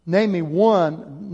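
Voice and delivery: drawn-out, deliberate challenge; slow challenge. Acted. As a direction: stretch out each word as a dare. Rhetorical challenge to congregation.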